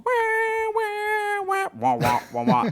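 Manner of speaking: high, loud imitated trumpet intro; mouth trumpet. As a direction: mouth instrumentation imitating his daughter. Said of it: "so she's going".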